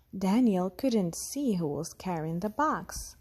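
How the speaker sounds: ordinary delivery, nothing marked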